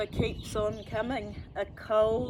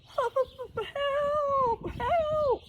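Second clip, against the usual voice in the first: very high, loud desperate cry; croaking cry for help; 6 dB louder than usual. Acted. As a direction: call out in panic, high. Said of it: "Old Truck croaks".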